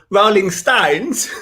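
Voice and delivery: drawn-out mock accent on "[name]"; mimicked bad accent, slow and drawn out; exaggerated own accent. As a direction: stretch "[name]" slowly in an overdone accent. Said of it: "It's 'cause of my accent".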